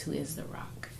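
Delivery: whispered, fading final words; dropped to a whisper. Real, not acted. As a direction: hush it like a confession of faith. Preaching the closing line.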